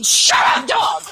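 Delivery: sudden high, loud shout of shut up; hammed-up shouted scolding; mock yell. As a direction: bark it at a teasing friend. Yells at friend mid-sentence.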